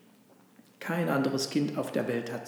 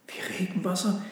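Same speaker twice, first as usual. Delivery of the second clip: high, rising shocked question; taken aback, voice lifting; dismayed; 5 semitones higher than usual. Acted. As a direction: echo it in disbelief. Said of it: "rief der Esel bestürzt".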